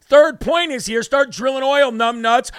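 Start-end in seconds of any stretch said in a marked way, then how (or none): none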